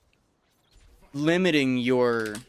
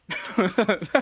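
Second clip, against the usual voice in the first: short laugh; 8 semitones higher than usual. Real, not acted. Laughing at his own imitation.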